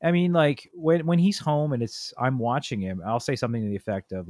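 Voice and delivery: ordinary talk, nothing marked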